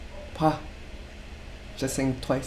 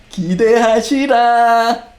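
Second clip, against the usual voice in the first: higher and louder playful promise; teasing hype; 7 semitones higher, 6 dB louder than usual. Shown as a demonstration. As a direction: promise something big, teasingly. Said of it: "It's kind of like saying".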